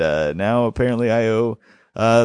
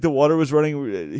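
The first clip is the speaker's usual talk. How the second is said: normal talking voice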